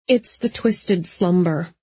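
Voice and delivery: ordinary delivery, nothing marked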